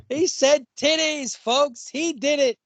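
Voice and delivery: high, drawn-out mock announcement; mock announcer. Co-host crowing over a friend's slip, mock-announcer voice.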